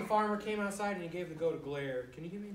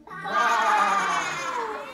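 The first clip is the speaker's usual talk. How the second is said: loud scream; 2 semitones higher, 10 dB louder than usual. Acted. Children shouting the animal's cry with glee. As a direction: let out a big happy yell.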